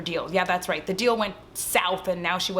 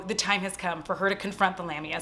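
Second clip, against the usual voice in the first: ordinary delivery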